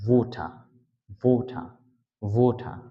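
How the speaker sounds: ওটা as "wo-tha", rounded O, no R; British water. Shown as a demonstration. Teacher turning water British, round O and aspirated T. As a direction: round the lips on ওটা, breathed T, drop the R. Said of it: "ওয়াটার থেকে হয়ে যায়".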